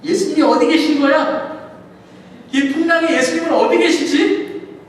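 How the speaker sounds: loud, high, anxious calls; frantic shouting. Acted. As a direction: shout for Jesus over the storm. Disciples searching in the storm.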